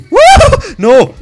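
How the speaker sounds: ordinary delivery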